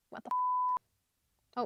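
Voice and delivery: mock-outraged squeal that cuts itself off before the swear; a high, slow squeak of disbelief; squeaky outraged what the. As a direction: shoot way up into a tiny squeak, drawn out slowly. Lily's exasperated reaction, acted out.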